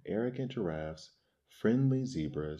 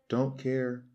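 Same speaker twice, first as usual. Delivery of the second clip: a higher, rude brush-off; snippy refusal, a bit higher; 6 semitones higher than usual. A narrator's reading. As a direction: dismiss them like a spoilt brat. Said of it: "said Baboon rudely".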